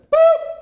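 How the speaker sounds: sudden high, loud "boop"; punchy vocal sound effect; imitated snap sound.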